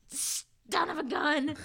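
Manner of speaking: a lightly hammed old-man curse; grumbling old man. Old villain cursing at Percy, slow mock grumble.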